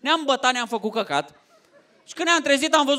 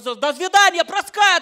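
nervous mock Russian; 8 semitones higher than usual. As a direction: play the soldier riled up. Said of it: "Da, seama, nervos".

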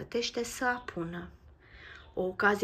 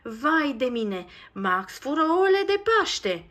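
high, loud and slow alarmed shout; horrified cry; 6 semitones higher, 9 dB louder, slower than usual. Acted. Nico sees Max stealing the eggs, a horrified loud cry. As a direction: cry out in horror. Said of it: "strigă ea îngrozită".

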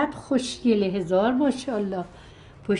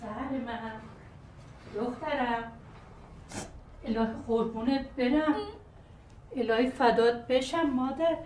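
tender comfort; 9 dB quieter than usual. Acted. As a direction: coax her gently out of tears. Grandmother comforts crying child.

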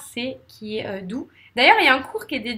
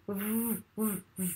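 softer revving R bursts; closed-mouth R; 12 dB quieter than usual. Shown as a demonstration. Teacher showing where R is made, softer revving bursts. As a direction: rev an R with the mouth nearly closed. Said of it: "La bouche est fermée".

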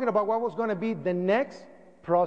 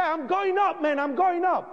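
insistent; 9 semitones higher than usual. Retold or imitated. Arguing with David, insisting.

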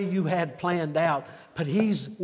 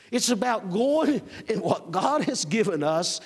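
louder, higher preaching that builds; full-force preaching; 6 semitones higher, 3 dB louder than usual. Acted. Pastor rousing the congregation with full-force preaching. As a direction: preach it out to the whole church.

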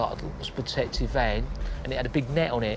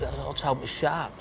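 ordinary delivery

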